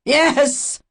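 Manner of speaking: high, loud, choked gasp; a strangled priest's high, loud admission; gasped yes. A narrator's reading. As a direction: force it out while being throttled. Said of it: "Finally he gasped".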